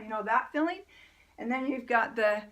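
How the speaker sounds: normal talking voice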